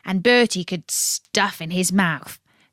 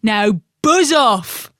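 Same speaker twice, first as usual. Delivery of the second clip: grumpy carp; 5 dB louder, slower than usual. Acted. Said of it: "said Colin".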